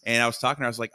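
ordinary delivery

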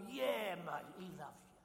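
put-on light Cockney accent, casual. Preacher voicing God for Londoners, mild Cockney accent. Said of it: "a funny language called Cockney".

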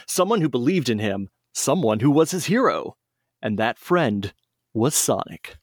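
lively read-aloud storyteller voice; storybook narration. Acted. Friends on a podcast hear a fan story read aloud, lively storybook narration. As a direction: tell it like a bedtime story for kids.

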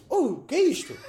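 mock startled yell; startled cry. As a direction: jump as if hit by a sudden puff. Reliving the surprise of the air puff.

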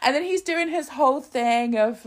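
normal talking voice

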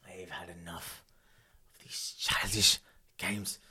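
hissed whisper; menacing whisper. Acted. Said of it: "you've heard Peter shout".

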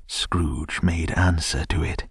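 plain reading voice

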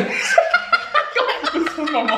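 slow, high laughing line. Real, not acted. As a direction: laugh it out slowly. Joking, laughing.

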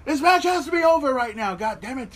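high, loud exasperated yell; re-enacted screaming. Fan recalling screaming at the match to be over, exasperated yell. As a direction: relive yelling at the match in protest. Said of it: "I remember screaming my l-, lungs off".

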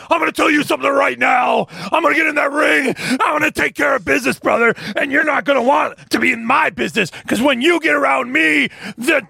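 high, shouted wrestler trash talk; wrestling promo. Acted. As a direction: bellow it like a pro wrestler hyping a match. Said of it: "somebody cutting a promo".

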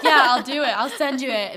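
imitating a friend's over-keen promise; mock eager promise. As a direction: quote them loud and high over a laugh. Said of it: "you were like".

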